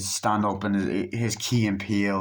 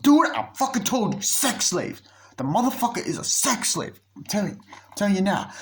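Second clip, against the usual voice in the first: excited friend; 10 semitones higher than usual. Retold or imitated. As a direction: insist wildly, voice climbing. Friend insists guy is sex slave.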